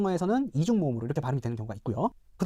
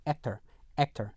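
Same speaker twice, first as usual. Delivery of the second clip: American actor ending in R-coloured "er"; 4 semitones lower, 6 dB quieter, much slower than usual. Shown as a demonstration. Teacher models American ending for learners, rhotic actor. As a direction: say actor with a clear final American R. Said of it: "일반 미국 영어에서는요".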